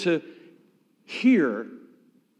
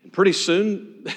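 usual speaking voice

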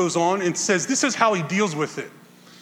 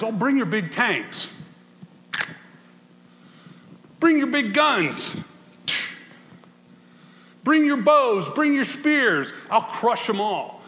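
mighty God defiant; 4 semitones higher than usual. Acted. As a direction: dare the armies to come, bring your hammered. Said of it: "God says".

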